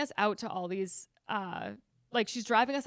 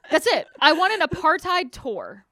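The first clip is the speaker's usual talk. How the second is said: mock demanding customer; 7 dB louder than usual. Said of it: "And I was like".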